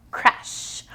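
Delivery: ordinary delivery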